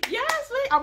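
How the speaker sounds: high squealed cheer, imitating an adoring girlfriend; mock cheering. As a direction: cheer on your man in a put-on squeal. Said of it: "she's above the water like".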